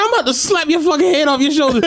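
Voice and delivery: high, laughing through the threat. Retold or imitated. Driver retelling his threat to kid, laughing. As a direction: say it cracking up. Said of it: "He's like".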